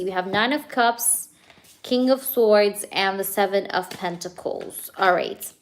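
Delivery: ordinary talk, nothing marked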